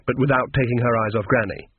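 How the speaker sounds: ordinary delivery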